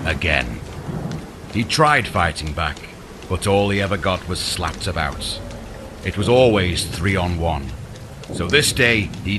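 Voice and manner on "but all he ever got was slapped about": usual speaking voice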